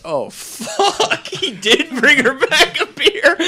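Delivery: high, loud quote breaking into laughter; delighted laughing shock, voice jumping up; laughing disbelief. Retold or imitated. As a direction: crack up as the realization hits. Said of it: "and I was like".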